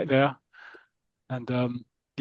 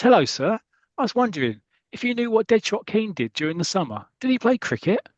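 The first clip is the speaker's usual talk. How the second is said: put-on boy's voice; 7 semitones higher than usual. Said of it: "He goes".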